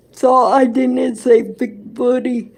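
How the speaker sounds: ordinary talk, nothing marked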